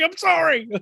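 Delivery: high-pitched imitated apology; mock apology. Host imagining the back rider apologizing, high mock apology. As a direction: squeal a sorry in jest.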